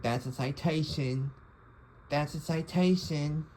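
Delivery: a snooty English teacher voice, British; imitating an English accent. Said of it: "in a, like, an English accent".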